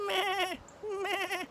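high soft bleating meh; goat bleat. Pretend. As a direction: bleat like a little goat, softly. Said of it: "Guess we might learn to speak goat".

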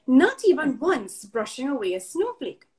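plain reading voice

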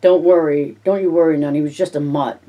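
ordinary delivery

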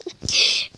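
airy, silent laugh fit; breathless giggle. Real, not acted. Laughing at her own failing run, breathless giggle. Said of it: "Gülüyorum şu an".

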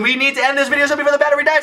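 rapid-fire rush of words; really fast. Pretend. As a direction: race through it without pausing. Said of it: "Dude, that was really fast".

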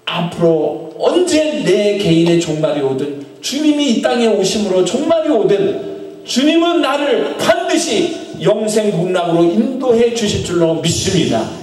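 ordinary delivery

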